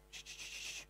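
hushed shh, shh imitating the shuffle; soft rhythmic shushing; shuffling noise. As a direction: whisper the swish of boxes sliding. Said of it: "He was doing a shh".

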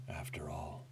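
unvoiced hushed whisper; soft secretive whisper. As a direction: breathe the words like a secret. Voicing whispering NPC.

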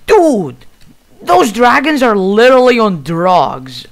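loud, high exclamation breaking into laughter; incredulous outburst. Real, not acted. Player reacting to wild dragons, loudly incredulous. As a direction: cry out in amused disbelief.

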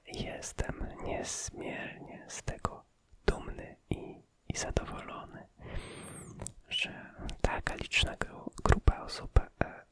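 normal talking voice

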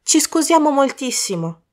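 high-pitched worried apology; anxious and apologetic; apprehensive. A narrator's reading. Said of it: "con apprensione nella voce".

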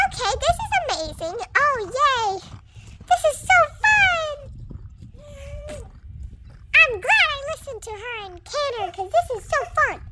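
high, delighted gush; bubbling over with joy; thrilled. Acted. Horse gushing to viewers about a fun canter, high and delighted.

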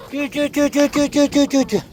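high repeated calling syllables; chicken call. Real, not acted. Woman calling her chickens over, high repeated call. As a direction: call the flock in, high pitched.

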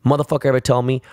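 normal talking voice

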